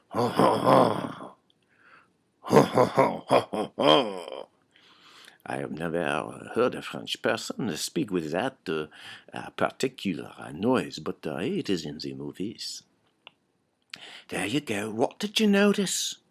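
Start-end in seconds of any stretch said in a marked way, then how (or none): 0.1-4.5 exaggerated guttural French laugh; guttural French chuckle. Shown as a demonstration. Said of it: "guttural affirmations such as". 5.5-12.8 English with a thick French accent; halting, low-pitched French-accented English. Shown as a demonstration. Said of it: "I changed the, the w-, way the sounds came out of my mouth".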